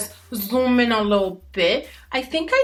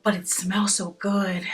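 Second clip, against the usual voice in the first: breathy aside whisper about the gloss; hushed little confession. Real, not acted. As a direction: lean in and whisper it like a secret.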